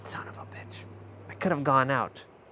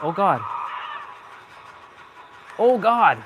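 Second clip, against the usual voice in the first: panicked gasp; 5 semitones higher, 6 dB louder than usual. Real, not acted. Killer chasing, gamer alarmed.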